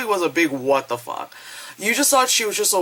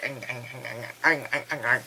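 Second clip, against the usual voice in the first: low guttural chomping; monster grunts; 4 semitones lower, 8 dB quieter than usual. Retold or imitated. Girl eating the dude, low creature grunts. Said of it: "the girl's like".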